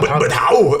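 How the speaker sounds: a panicked squeak of disbelief; mock shocked Arnie.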